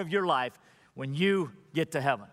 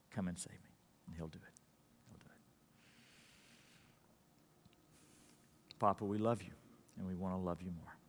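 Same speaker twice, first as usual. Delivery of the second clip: much softer, intimate invitation; quiet prayer; 7 semitones lower, 10 dB quieter than usual. Acted. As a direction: speak gently into the hush. Altar call and closing prayer.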